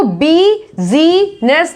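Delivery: बीजीनेस in three slow beats, bee-zee-ness; wrong sounded i. Shown as a demonstration. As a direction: misread बीजीनेस with long ee sounds. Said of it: "नहीं बोलते हैं".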